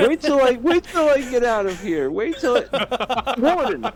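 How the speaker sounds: high, laughing prisoner voice; mock prisoner. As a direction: plead with the warden through laughter.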